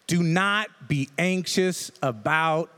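slow, deliberate scripture read; measured verse reading. Shown as a demonstration. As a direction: read each word slowly and weightily. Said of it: "the first part of this verse looks like this".

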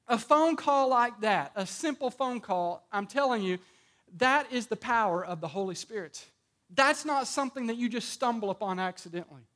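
ordinary delivery

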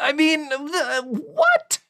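very high, squeaky disbelief; what?! in a sky-high squeal; incredulous squeak. Real, not acted. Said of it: "incredulous at the scene".

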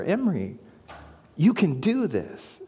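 soft encouragement. Retold or imitated. Dad encouraging trembling daughter.

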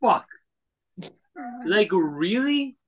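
high, disbelieving outburst; incredulous. Real, not acted. To cohost about price-fixed bread, a high incredulous what. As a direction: pitch it up in shock.

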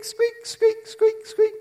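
high squeaky car noise; mimicked brake squeak; squeak imitation.

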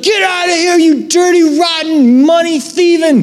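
Jesus roaring at the money thieves; Jesus shouting. Retold or imitated. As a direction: drive them out with a furious yell. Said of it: "he's like".

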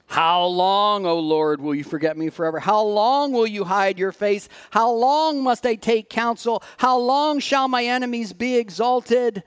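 ordinary talk

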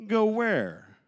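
high, loud baffled question; puzzled, caught off guard; confused disciple. Acted. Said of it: "the disciples with their hands in the huddle going".

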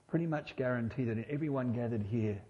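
normal talking voice

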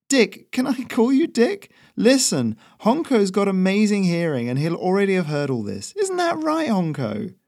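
high, easygoing chatty messenger; Ferrino's voice. Acted. Messenger reassures the nervous local, high and easy.

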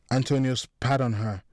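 ordinary delivery

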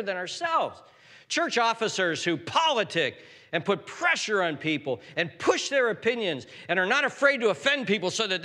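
high, loud, driving sermon delivery; full-force preaching. Real, not acted. Preacher condemns politicking church officers, preaching at full force. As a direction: thunder against the powerful from the pulpit.

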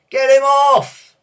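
long, high, very loud heckle; drawn-out roar from the stands; crowd shout. A narrator's reading. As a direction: bellow it slowly like an angry crowd. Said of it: "people began to shout".

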